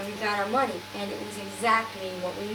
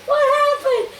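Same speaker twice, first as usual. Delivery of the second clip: high, loud imitation of a baffled man; alarmed question; 8 dB louder than usual. Friend asking the crying woman again, imitated loud alarm. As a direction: demand to know, in mock alarm.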